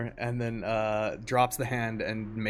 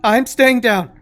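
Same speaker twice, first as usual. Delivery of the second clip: high, very loud protest; surrendering gnome; 14 semitones higher, 18 dB louder than usual. Acted. Gnome protesting to its captor, high shrieked surrender. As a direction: shriek it back in panic.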